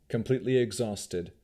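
ordinary delivery, nothing marked

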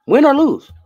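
high, loud exclamation; emphatic call. Real, not acted. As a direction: belt it out to reassure him. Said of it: "that's what I need you to know".

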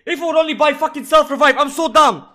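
loud, high-pitched rant at yourself; real exasperated yelling; frustrated self-reproach. Real, not acted. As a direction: shout in frustration at your own mistake. Berates self over missed purchase.

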